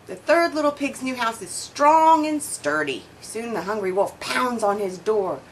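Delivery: ordinary delivery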